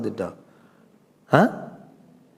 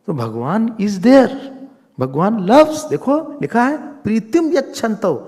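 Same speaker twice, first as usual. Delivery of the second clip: loud, high preaching at full force; fervent preaching; 8 semitones higher, 7 dB louder than usual. Acted. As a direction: proclaim it to the hall with fire. Preaching with rising fervour.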